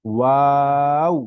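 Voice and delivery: ওয়াও stretched very slowly and loud, rising at the end; teacher models ওয়াও slowly; drawn-out letter name. Shown as a demonstration. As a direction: hold ওয়াও long with rounded lips. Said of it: "আবারও বলছি আমি".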